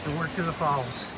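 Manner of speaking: ordinary talk, nothing marked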